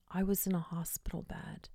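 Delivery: soft confiding whisper. Retold or imitated. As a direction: whisper the memory. Spirit recalls his hospital bed.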